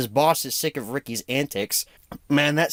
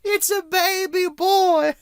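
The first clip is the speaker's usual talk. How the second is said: drawn-out high announcement; 3 dB louder, slower than usual. Retold or imitated. Quoting the newborn scene.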